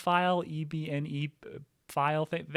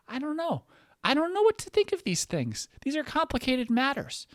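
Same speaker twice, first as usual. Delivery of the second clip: much higher, airy falsetto musing; mock high voice; 12 semitones higher than usual. Comic mocking a confused defendant, high falsetto. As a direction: float it up high in mock innocence.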